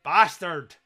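bellowed Yorkshire farmer's curse; loud gruff Yorkshire yell; Yorkshire accent. Acted. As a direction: shout it like an angry Yorkshire farmer. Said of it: "in a Yorkshire accent".

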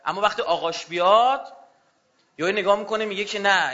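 normal talking voice

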